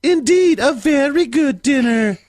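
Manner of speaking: put-on high girlish voice. Mrs Cratchit praising the dinner in a high girl voice. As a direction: lilt it like a prim young woman. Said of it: "You're better at the girl voice".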